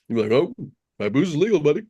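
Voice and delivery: ordinary talk, nothing marked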